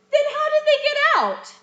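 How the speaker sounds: high, loud stage question; big curious stage voice; eager question. Shown as a demonstration. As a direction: project it out to the back row. Demoing a student's line.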